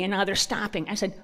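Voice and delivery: ordinary delivery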